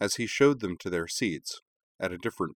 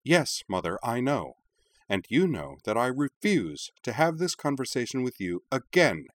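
firm anger; 6 semitones higher than usual. Acted. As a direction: shut the topic down hard. Heated argument with her mother.

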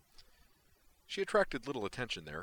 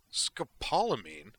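slow, stunned incredulous question; horrified disbelief, drawn out; horrified blurt; 4 semitones higher, 6 dB louder, slower than usual. A narrator's reading. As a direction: blurt it out in horror. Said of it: "McCoy blurted, as horrified".

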